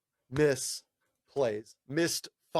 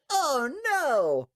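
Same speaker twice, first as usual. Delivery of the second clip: mock dismay; 11 semitones higher, 4 dB louder than usual. Reacting to a schedule clash.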